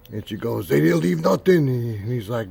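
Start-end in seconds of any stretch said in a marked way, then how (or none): 0.7-1.7 high, loud incredulous outburst; frantic disbelief. Retold or imitated. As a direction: mimic a frantic girl's shocked cry. Cousin frantic over Beatles leftovers.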